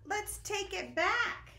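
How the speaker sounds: high, loud bleat then plea; high insistent sheep; sheep crying out. Acted. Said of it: "one sheep cried".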